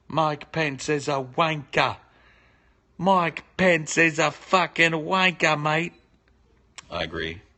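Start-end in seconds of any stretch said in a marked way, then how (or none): 0.1-5.9 slow, high mock British accent. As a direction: jeer in a hammed-up British accent.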